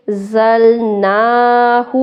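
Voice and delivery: زلناہ drawn out very slowly on naa; stretched vowel. Shown as a demonstration. Teacher stretches the vowel for students. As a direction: pull the naa of زلناہ long. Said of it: "کھڑا زبر ہے، کھینچیں گے".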